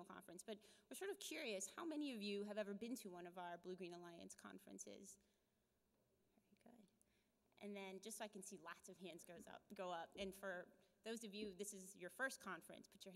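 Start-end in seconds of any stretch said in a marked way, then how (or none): 6.3-6.8 whisper. Real, not acted. Host reacting to raised hands.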